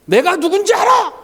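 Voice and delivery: high-pitched imitation of an irate customer; mock angry outburst. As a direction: act out an indignant restaurant customer. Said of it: "저렇게 큰 소리를 치냐". (화난 손님 흉내 내며 호통치듯)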